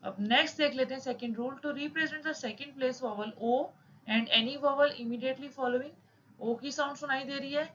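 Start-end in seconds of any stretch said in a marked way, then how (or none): 1.6-5.9 reads the textbook rule out slowly, word by word; slow rule reading. Shown as a demonstration. Teacher reading a shorthand rule aloud to students, slow dictation. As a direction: dictate the rule at half speed.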